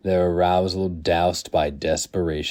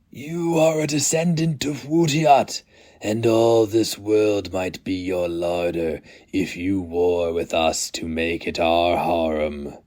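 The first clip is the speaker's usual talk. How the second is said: ancient Inkoroi elder. Acted. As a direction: intone it like a ritual blessing over newborns. Inkoroi elders address the hatchlings.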